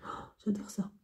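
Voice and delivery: whispered, voiceless delight. Real, not acted. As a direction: breathe it out as a secret. Whispered aside about perfume. (chuchoté)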